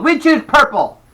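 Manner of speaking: high, loud eager call; excited. A narrator's reading. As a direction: blurt it out proudly. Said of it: "I called".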